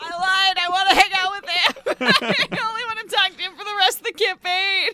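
high, loud and fast eager gushing; excited gush. Real, not acted. Player gushes about hanging out with the boy. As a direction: gush like a smitten fan.

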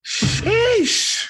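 high, stretched-out sheesh of amazement; impressed sheesh. Real, not acted. Host hears the five-one win, high amazed sheesh. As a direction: marvel at the score.

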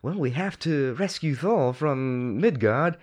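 put-on British accent, acting out a movie line. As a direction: imitate a British-accented Asgardian. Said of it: "everybody spoke British except for Hogun".

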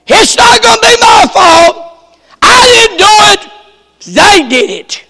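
loud, high defiant denial; defiant Samson. Retold or imitated. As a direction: shout off the blame like Samson. Samson voiced shifting blame.

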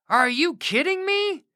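high, indignant "Are you kidding me?"; "Are you kidding me?" as a furious complaint; outraged disbelief. Acted. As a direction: spit out "kidding" in angry disbelief. Naaman's face turned red.

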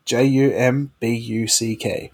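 slow, J U M B U C K letter by letter; spelling it out. Shown as a demonstration. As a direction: spell J U M B U C K one letter at a time. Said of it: "So this one's gonna be jumbuck".

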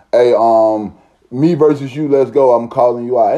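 a hint of a pompous challenger; mock formal challenge. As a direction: pretend to politely call someone out to fight.

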